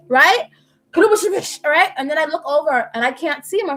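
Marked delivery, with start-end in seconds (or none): 0.9-1.6 high imitated clatter and crash; crash noise. Repeating the crash for listeners, imitated crash noise. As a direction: mimic the crash sound, pitched up. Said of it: "I heard".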